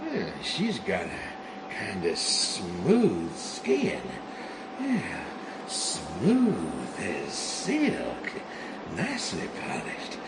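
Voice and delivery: low, slow and soft, lingering on each word; dreamy murmur. Acted. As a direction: murmur it like stroking something precious. Caressing the bomb, dreamy.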